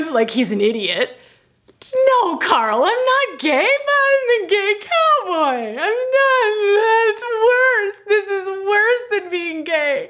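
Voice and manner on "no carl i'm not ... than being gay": high, loud melodramatic crying; drama queen wail. Retold or imitated. Correcting the chiropractor, high loud dramatic wailing. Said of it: "drama queen".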